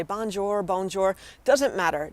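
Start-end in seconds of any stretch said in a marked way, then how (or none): 0.0-0.6 bonjour in a heavy American accent, hard final R; bad American accent. Shown as a demonstration. Teacher showing learners a clumsy try, bad American accent. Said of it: "if you have a horrible accent". 0.6-1.2 bonjour as BONE-JOR, American accent; bad American accent. Shown as a demonstration. Teacher reassuring learners with bad accents, American-accented bonjour. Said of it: "if you have a horrible accent".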